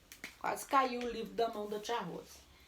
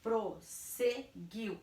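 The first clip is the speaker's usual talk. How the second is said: "Prosseguiu" slow, in three separate pieces; syllable by syllable; 3 dB quieter, much slower than usual. Shown as a demonstration. Teacher recaps the double-S rule, "Prosseguiu" syllable by syllable. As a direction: break "Prosseguiu" at each syllable. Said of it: "Eles se separam".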